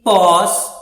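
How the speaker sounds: "pós" pushed out loud and long. Shown as a demonstration. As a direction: hit "pós" harder, stretched. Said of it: "mais alto".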